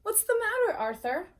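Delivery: mother voice. Acted. Mother's line in read-aloud story.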